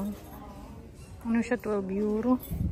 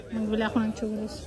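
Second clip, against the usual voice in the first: usual speaking voice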